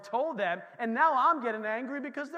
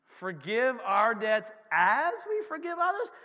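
puzzled objection; slower than usual. Pretend. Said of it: "You're like".

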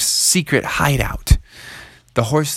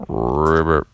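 low, soft ribbit call; low soft frog croak; quiet frog croak; 8 semitones lower, slower than usual. Acted. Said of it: "tried to make it sound really quiet".

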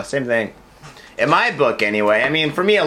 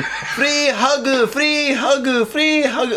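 mock free-hug calls; 10 semitones higher, much slower than usual. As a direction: imitate the girls calling out, high. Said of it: "but they're just screaming".

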